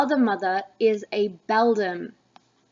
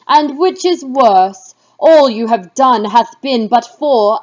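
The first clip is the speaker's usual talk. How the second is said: loud, scolding dramatic reading; angry witch goddess; 11 dB louder than usual. Acted. Witch queen scolding her witches for meddling, loud and stern. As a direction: rail at the witches as their furious queen.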